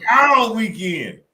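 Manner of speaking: loud, high, drawn-out holler; whooping boast. Real, not acted. Co-hosts crow about being out all weekend, whooping. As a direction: stretch it out like a party cheer.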